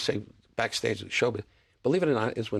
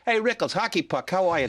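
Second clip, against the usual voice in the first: a high, hearty call from a passer-by on the street; friendly street greeting; 7 semitones higher, 3 dB louder than usual. Retold or imitated. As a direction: call out to him like a fan spotting him. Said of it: "I go".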